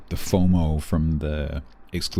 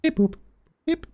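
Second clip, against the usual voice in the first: high robot beeps. Pretend. Playful robot beeps in game.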